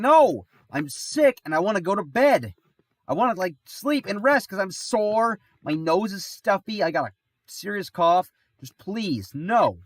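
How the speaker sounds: high, loud, exasperated pleading; hammed-up whiny outburst; acted loud protest. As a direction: plead at the top of your voice to be let off. Retold argument with mom, protesting.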